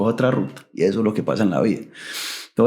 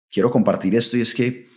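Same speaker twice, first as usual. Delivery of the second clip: ordinary talk, nothing marked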